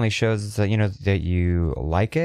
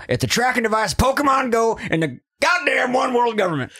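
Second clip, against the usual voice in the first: conspiracy rant; 11 semitones higher than usual. Pretend. Cohost joins the Alex Jones bit, high frantic rant.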